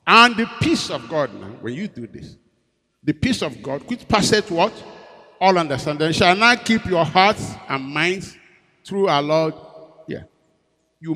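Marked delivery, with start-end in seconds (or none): 0.1-9.5 loud, high preacher's cry; rising pulpit fervor; fervent preaching. Acted. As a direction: proclaim the scripture with full force. Preaching scripture at full force.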